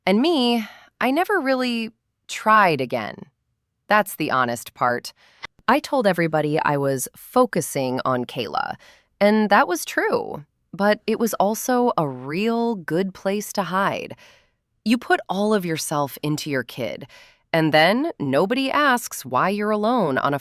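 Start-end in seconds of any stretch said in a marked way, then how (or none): none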